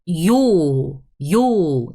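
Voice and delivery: "yo" drawn out with a full, clear final vowel; "yo" fully articulated; clearly pronounced yo. Shown as a demonstration. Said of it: "I did really pronounce it H, like, very clearly".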